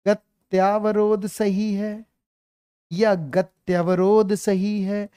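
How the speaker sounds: very slow, weighing each option; teacher slowly posing which is right; slow quiz question. Shown as a demonstration. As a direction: drag out the choice like an exam. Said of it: "चार बार लिख देगा".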